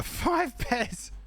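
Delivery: slow, chuckling repeat of the number; savouring the absurd number; laughing disbelief. Real, not acted. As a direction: laugh it out slowly, amused.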